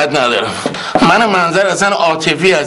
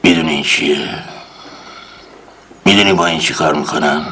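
menacing interrogator; 9 semitones lower, faster than usual. Acted. Uncle shows the tool menacingly, low voice. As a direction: hold up the tool and threaten.

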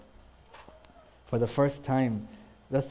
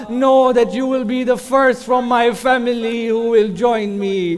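building from speech to a loud, higher, half-sung wail; rising grief-laden recitation that swells into near song; mournful majlis lament; 9 semitones higher, 10 dB louder than usual. Acted. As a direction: chant the tragedy so the mourners weep.

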